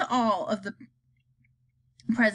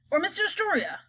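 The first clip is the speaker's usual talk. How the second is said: president guessing; 9 semitones higher, faster than usual. Acted. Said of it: "said the president".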